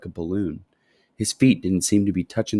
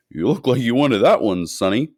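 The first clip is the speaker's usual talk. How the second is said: lightly voiced friendly shopkeeper; a genial shopkeeper's voice, a bit louder; pleasant shopkeeper; 3 semitones higher, 5 dB louder than usual. A narrator's reading. Shopkeeper pleasantly teasing Charlie over his candy, friendly. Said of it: "the shopkeeper said pleasantly".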